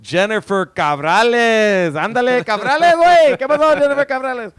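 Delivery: high, loud hammed-up Mexican accent, rolling the name; mock Mexican accent. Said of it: "with your sweet-ass Mexican inflections".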